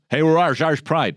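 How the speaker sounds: loud high mock boast; boastful Irish-American. Comedian mocks Irish-American pride with a loud high boast. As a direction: puff up like a proud Yank shouting his heritage.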